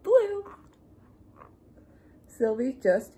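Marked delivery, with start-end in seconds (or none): 0.0-0.5 blue called out high and loud; light up the word blue with delight; delighted reveal. Acted. Sylvie to the sky, delighted high call.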